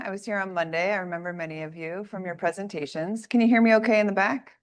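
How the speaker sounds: ordinary talk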